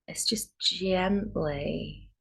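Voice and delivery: calm meditation guide with long pauses; guided meditation. Real, not acted. Yoga teacher easing students into Yoga Nidra, calm guided meditation. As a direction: settle them gently into stillness, leaving space between phrases.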